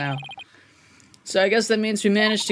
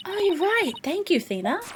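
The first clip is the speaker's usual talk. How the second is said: lightly voiced girl, pitched up and soft; high, gentle girl; 11 semitones higher, 4 dB quieter than usual. Girl thanking her friend Thena, high and gentle. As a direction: thank a friend in a higher, softer voice.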